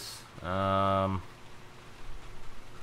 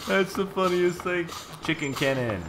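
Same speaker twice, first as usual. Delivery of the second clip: laughing; 10 semitones higher, 3 dB louder than usual. Real, not acted. Laughing at chicken cannon.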